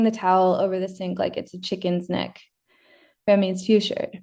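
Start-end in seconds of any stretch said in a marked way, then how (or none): none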